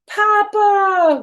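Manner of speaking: high, loud delighted shout; joyful call. Acted. Twins spotting Papa at the airport, calling out loud and high. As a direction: call out in pure joy. Kids greeting father at airport.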